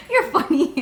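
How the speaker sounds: laughing through the words. Real, not acted. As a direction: giggle while teasing dad. Laughing at dad.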